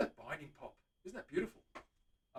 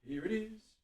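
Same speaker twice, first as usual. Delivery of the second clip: ordinary talk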